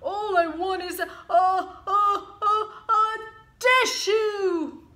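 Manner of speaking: high, loud stuttering before a sneeze; building to a sneeze. Acted. Sick wolf stuttering toward a sneeze at the door. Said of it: "Big Bad sneezed".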